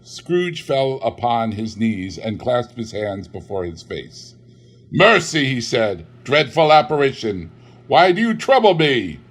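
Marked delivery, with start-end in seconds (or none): 4.9-9.2 high, loud begging for mercy; terrified plea. Acted. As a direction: plead on your knees. Scrooge on his knees begging.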